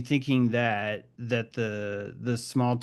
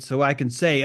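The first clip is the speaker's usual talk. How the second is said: ordinary delivery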